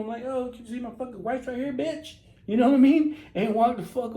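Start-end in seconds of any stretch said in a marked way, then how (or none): 0.2-2.1 high-pitched acted outrage, not shouted; indignant rebuff. Man telling flirting woman his wife is here, mock indignant.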